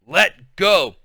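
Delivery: loud sharp holler. A narrator's reading. Mother hollering at fighting daughters, loud. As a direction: bark it to break up the fight. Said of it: "she hollered".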